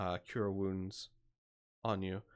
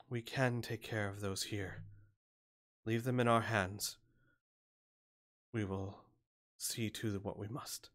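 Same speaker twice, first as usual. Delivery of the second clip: soft, slow, weary cleric's voice; exhausted cleric; 6 dB quieter than usual. Acted. Exhausted cleric offering to tend the wounded, soft and weary.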